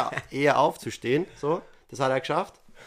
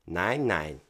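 imitating roommate; 7 semitones lower, slower than usual. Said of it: "Er so".

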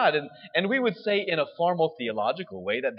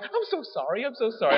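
high-pitched imitation of an embarrassed mom; mock flustered apology; 8 semitones higher, faster than usual. Embarrassed mom apologizing for her kid.

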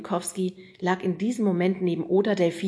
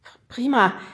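flustered stammer; 3 semitones higher, 7 dB louder than usual. Acted. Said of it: "fing schon wieder an zu schwitzen".

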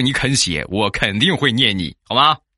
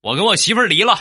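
friend's news; 11 semitones higher, faster than usual. Retold or imitated. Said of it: "打电话就跟我说".

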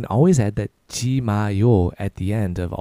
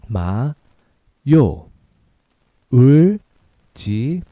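slow and drawn out, louder on 울지; slow syllable drill; 5 dB louder than usual. Shown as a demonstration. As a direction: model it slowly for repetition. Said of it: "A little faster".

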